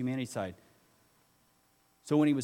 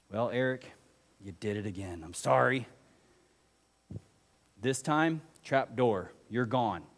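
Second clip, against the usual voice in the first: mock stern judge; 2 semitones lower than usual. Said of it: "he's not up there going".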